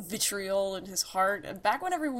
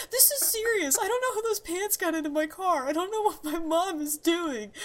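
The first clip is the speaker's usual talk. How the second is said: much higher, upset and pleading; panicked girl; 11 semitones higher than usual. Retold or imitated. Retelling a friend's panic about pants in her mom's car, high and upset. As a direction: squeak it out like a freaked-out teen girl. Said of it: "she'd be like".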